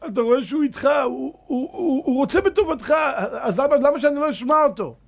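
high, loud and heartfelt; loving and eager. Shown as a demonstration. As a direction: sell the warmth of love. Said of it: "ויש אחד מדבר באהבה". (דרשן מתאר באהבה ובהתלהבות)

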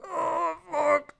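crying to himself. Retold or imitated. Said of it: "I'm crying to myself".

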